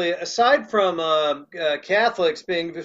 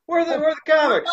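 mock proud comics; 9 semitones higher, faster than usual. Repeats the joke punchline.

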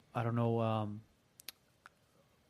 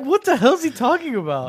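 baffled; 10 semitones higher, 4 dB louder than usual. Retold or imitated. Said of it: "I'm like".

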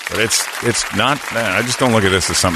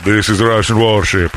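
louder mock Russian accent announcing itself; 4 dB louder than usual. Host imitating the Russian warship, mock Russian accent. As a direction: announce it like a Russian ship radio. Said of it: "cool accent like I did".

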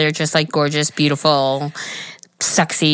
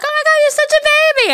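high-pitched squealed teasing; mock gushing; 4 dB louder than usual. Friends tease the husband about his age, gushing.

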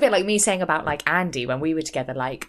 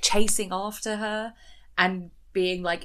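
ordinary talk

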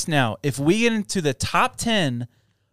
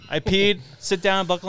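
usual speaking voice

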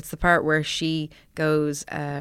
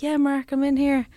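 mild retold call from the couch, pitched much higher; 9 semitones higher, 3 dB louder than usual. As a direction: call through to the next room, high and light. Child calling to visiting friend.

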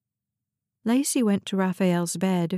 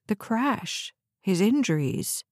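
ordinary delivery, nothing marked

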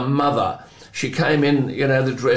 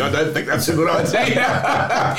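laughing paraphrase; 5 semitones higher than usual. Retold or imitated. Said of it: "Which really was shorthand for saying".

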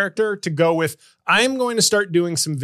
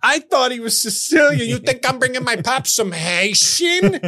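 higher, indignant put-on voice; mock Italian American dater; 7 semitones higher than usual. Comic as dating-app match refusing him, mock indignation. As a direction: push it up in mock disbelief. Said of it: "They'd be like".

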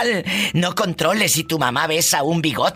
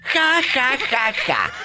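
mock laugh; 8 semitones higher, slower than usual. Mocking laugh after scolding.

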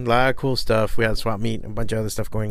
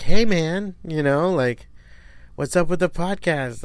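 friendly stranger; 6 semitones higher than usual. Retold or imitated. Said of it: "they were like".

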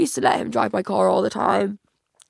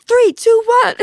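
high, loud crowd countdown; countdown shout; 13 semitones higher, 8 dB louder than usual. Retold or imitated. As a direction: shout it like a stage MC. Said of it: "the guy on stage with the mic would be like".

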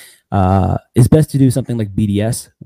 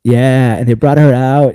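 usual speaking voice

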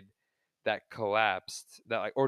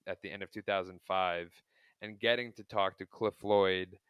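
ordinary talk, nothing marked

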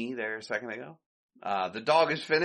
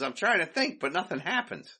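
Curly voice; 7 semitones higher than usual. Retold or imitated. Curly answering Mo.

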